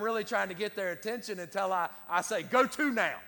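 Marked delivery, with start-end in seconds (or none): 2.4-3.1 louder, higher, commanding dad voice; stern dad voice. Shown as a demonstration. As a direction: call the kids to attention like a parent. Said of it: "I don't say it like that".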